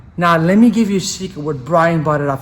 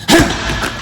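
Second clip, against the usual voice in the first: relaxed exhale; 9 semitones higher than usual. Shown as a demonstration. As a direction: let the breath go.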